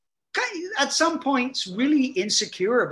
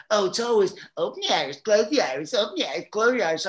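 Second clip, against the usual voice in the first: fast, higher sing-along mimicry; mocking rant; 4 semitones higher, faster than usual. Actor mocking his co-star's repeated orders, quick and higher. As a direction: parrot the orders back in mockery. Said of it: "I just went off on Don".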